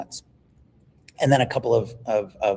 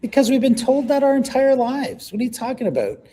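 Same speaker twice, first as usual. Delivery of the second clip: high, loud, exasperated protest; heated outburst; 13 semitones higher, 4 dB louder than usual. Real, not acted. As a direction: burst out incredulously, pitch shooting way up. Heated reply to interviewer's question.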